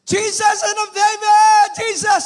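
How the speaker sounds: high, loud, slow cry of a desperate mother; desperate shout. Retold or imitated. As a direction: scream it out to Jesus across the crowd. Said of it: "Sigaw na sigaw eh". (sigaw na sigaw na pagtawag)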